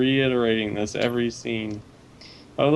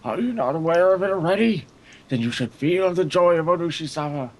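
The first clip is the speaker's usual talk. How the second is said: high, raised fervent preacher voice; fervent old man; 6 semitones higher than usual. Acted. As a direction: lift it up with zealous joy.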